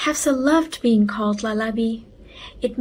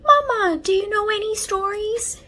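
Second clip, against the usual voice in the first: high, loud pleading call; very high eager child; eager little boy; 10 semitones higher, 3 dB louder than usual. A narrator's reading. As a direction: beg Mama for a story. Excited boy begging.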